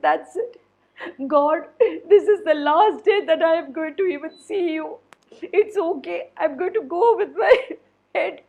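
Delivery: laugh-cry through the resignation; tearful chef. Acted. Chef resigned to losing his head, crying.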